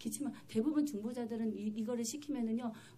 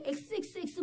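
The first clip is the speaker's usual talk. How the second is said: high mock swearing, censored; imitated cursing demon; 7 semitones higher than usual. Preacher voices the spirit's curses, mock swearing. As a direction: mimic a spirit spitting curses. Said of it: "막 이러면서 막 이러는 거예요".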